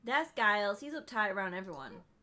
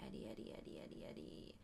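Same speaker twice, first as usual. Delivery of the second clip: whisper; 16 dB quieter, faster than usual. Pretend. Whispering to wake a character.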